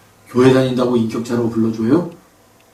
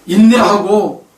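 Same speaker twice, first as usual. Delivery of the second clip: each virtue laid out slowly, climbing higher; slow preaching list; 7 semitones higher, slower than usual. Acted. Preacher to congregation, slow building list.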